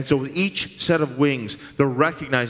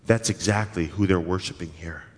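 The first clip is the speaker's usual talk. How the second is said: normal talking voice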